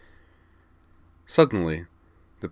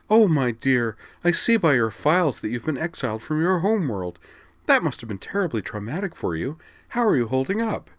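sugary, higher mock concern; mocking counselor imitation; 9 semitones higher, 3 dB louder than usual. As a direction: parody her fake sympathy. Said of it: "mocking imitation of Martine Leclerc's".

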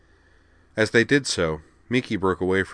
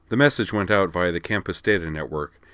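ordinary delivery

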